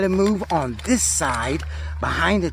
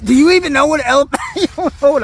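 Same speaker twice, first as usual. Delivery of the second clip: louder teasing repeat; 9 semitones higher, 6 dB louder, faster than usual. Real, not acted. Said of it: "Do you even know what an elephant is?".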